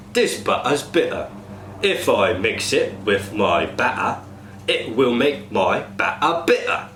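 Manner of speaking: "Betty Botter", "butter" with glottal T; tongue twister in Cockney. Shown as a demonstration. Coach leads glottal stop exercise for cast. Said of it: "good exercise for the glottal stops".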